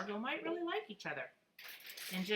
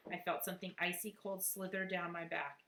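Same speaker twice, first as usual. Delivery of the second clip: ordinary delivery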